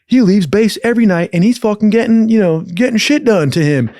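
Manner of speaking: high, loud mock-accusing rant; ratting out the boss. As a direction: point at the boss and rat him out loudly. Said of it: "And I was like".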